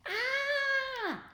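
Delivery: very high held cry; falling cry. A narrator's reading. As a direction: wail while plunging through the sky. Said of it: "cried Mister Rath".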